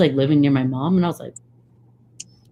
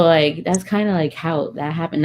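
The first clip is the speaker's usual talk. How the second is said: ordinary delivery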